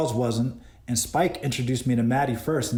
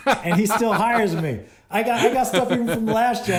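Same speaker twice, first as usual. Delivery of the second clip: laughing; 9 semitones higher, 3 dB louder than usual. Real, not acted. Laughing about being rehired.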